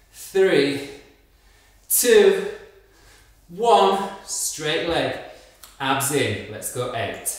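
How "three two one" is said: building count. Real, not acted. Coach counting down rising.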